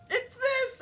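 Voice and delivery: very high, loud squeaky shout; hammed-up high cry; mock shouting. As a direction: yell it like a cartoon. Feminine voiced shouting.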